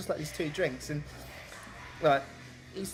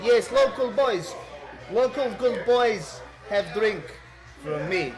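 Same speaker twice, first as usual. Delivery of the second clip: put-on slow, loud, high Eastern European accent; 6 semitones higher, 6 dB louder, slower than usual. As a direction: welcome them grandly, imitating an Eastern European. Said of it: "default Eastern European accent".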